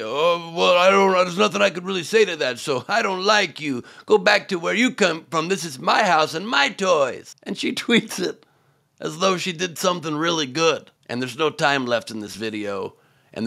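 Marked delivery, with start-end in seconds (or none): 0.0-7.4 childish, higher mock whine; sulky kid refusing to argue; mocking Green. Comedian voices Green's tweet logic, lightly mocking whine. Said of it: "I just say".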